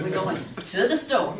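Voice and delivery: Southern-style blending of "to the store" into one; words run together. Shown as a demonstration. Teacher joking about Southern speech, words run together. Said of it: "It's almost like it's one word".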